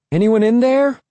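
high-pitched questioning call. A narrator's reading. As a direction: call high to someone behind a door. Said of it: "I called one more time".

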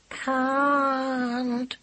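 high, whining little kitten. A narrator's reading. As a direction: whine it like a hurt kitten. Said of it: "whined Squintum, still in a kitten's voice".

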